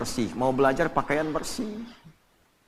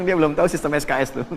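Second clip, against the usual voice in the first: laughing quote; faster than usual. Retold or imitated. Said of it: "Saya bilang".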